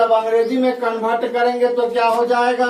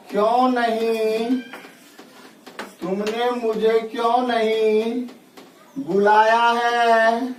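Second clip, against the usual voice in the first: slow dictation; slower than usual. Shown as a demonstration. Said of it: "teacher slowly dictates example sentence".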